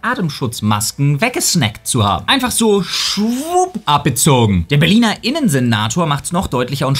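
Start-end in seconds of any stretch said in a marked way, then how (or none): 2.3-4.7 playful high voice. Pretend. Playful aside on stolen masks.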